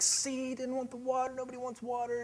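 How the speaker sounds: high, soft mock child voice; discouraged kid, imitated. Son turned down by a stranger, high soft complaint to dad. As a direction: protest in a small, high voice.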